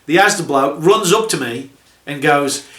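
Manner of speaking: ordinary talk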